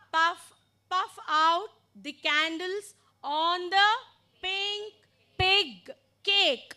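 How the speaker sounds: slowly, with gaps between phrases; slow demonstration. Shown as a demonstration. Teacher models the sentence for learners, slowly. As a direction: say it slowly for learners, word groups spaced out. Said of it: "so I told it slowly once".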